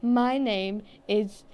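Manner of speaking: slow, deadpan mock introduction; drawn out like a clueless spy; slow mock intro. Friend mocking secret agencies, slow deadpan.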